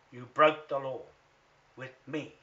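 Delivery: accusing the lawyer, loud and furious; loud accusation. Real, not acted. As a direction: bellow it straight at the lawyer. Accusing Shannon Withers publicly.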